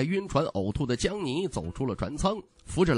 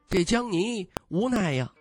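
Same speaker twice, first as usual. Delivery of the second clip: ordinary delivery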